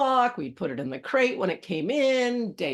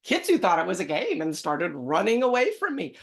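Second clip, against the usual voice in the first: usual speaking voice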